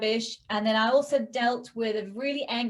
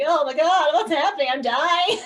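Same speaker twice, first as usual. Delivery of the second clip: hammed-up dramatic panic, a bit louder; mock panic; 5 semitones higher, 7 dB louder than usual. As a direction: play at being terrified of dying. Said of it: "So dramatic".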